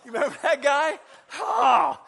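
loud, high laughing call; excited shout. Real, not acted. Preacher laughing loudly to the congregation. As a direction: burst out with delight.

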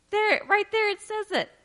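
high, laughing gasp of discovery; excited gasp. Real, not acted. Preacher delighting the congregation with an excited, high laughing gasp. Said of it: "I'm like".

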